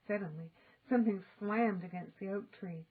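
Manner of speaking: plain reading voice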